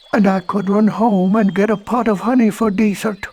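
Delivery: high cheerful cartoon bear; Pooh voice. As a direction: play Pooh, delighted and hopeful.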